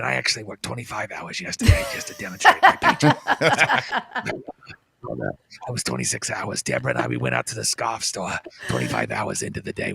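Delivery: very low, droning, detached expert; Fauci impression. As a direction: drone on flatly with long uhs. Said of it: "but he's going".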